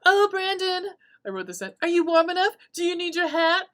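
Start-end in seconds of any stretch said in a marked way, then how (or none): 0.0-0.9 high, louder fussing mother voice; doting mom. Retold or imitated. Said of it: "she goes". 1.8-3.7 high, louder fussing mother voice; doting mom. Retold or imitated. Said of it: "she goes".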